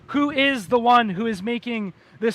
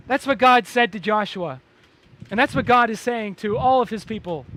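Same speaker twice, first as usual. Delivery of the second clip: ordinary talk, nothing marked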